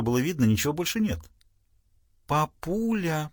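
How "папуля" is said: coy daughter. Acted. Pam voiced, soft coy.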